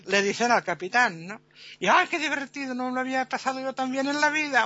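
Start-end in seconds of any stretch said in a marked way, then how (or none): 1.9-4.6 high, quick gush of enjoyment; delighted exclamation. Acted. Spectator enjoying the captain's humiliation, high delighted gush. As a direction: rush it out in happy delight.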